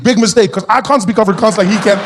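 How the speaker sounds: ordinary delivery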